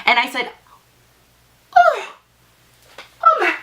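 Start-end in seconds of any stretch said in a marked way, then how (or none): 1.8-3.5 high, loud outraged gasp of disbelief; retelling the moment the box hit her ankle, shrieking in shock. Retold or imitated. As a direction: squeal it like you cannot believe she just did that. Woman threw a box at her.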